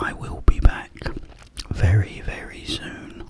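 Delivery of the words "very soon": usual speaking voice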